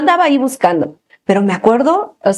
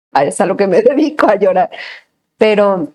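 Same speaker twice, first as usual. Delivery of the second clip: ordinary talk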